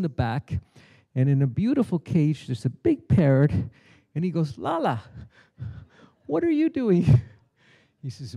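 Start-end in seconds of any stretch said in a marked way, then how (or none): 4.6-7.1 astonished saint. Acted. Saint shocked to find parrot.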